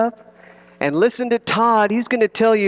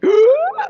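sharp gasp; 5 dB louder than usual. Pretend. Diving into pretend water.

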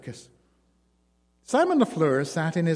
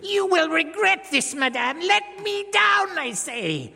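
furious scream; 4 dB louder than usual. A narrator's reading. Dwarf groom raging at his bride, shrieking scream. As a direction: scream it out in rage. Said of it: "screamed in a paroxysm of fury".

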